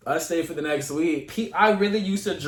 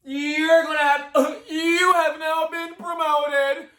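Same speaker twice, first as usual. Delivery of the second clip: laughing; 9 semitones higher, 3 dB louder, slower than usual. Real, not acted. Laughing while telling.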